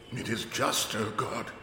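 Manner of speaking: high, soft, pleading curate voice; a broken priest muttering to God, pitched high; raving curate. Acted. Half-mad curate crying out to God, high and soft. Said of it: "he would say over and over again".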